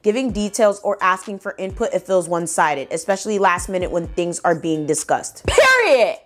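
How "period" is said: punched high and loud; emphatic period. Real, not acted. Ending her chat message reading, higher louder punch. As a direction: slam it shut, higher and louder.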